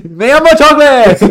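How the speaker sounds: very high, loud hammed-up Spanish accent; mock Spanish accent. Friend joking about a character, loud high Spanish accent. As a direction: belt it in a silly Spanish accent. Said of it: "Spanish line for character".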